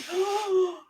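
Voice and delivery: sharp high startled gasp; surprised gasp. Retold or imitated. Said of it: "they're like".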